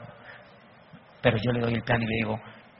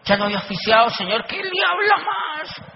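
mock eager protest; 11 semitones higher, 7 dB louder than usual. Voicing his own eager thoughts.

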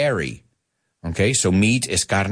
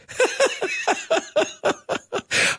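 breathy giggle at own pun; high airy laugh. Real, not acted. A teacher laughs at his own pun, airy and high.

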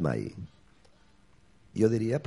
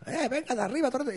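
higher, hammed-up order to climb aboard; mock bossy director; 12 semitones higher than usual. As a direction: toss out a mock command, pitched up. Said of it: "Y digo".

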